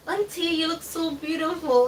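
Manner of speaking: a hint of a sweet three-year-old; little child. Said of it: "there's this little cute boy".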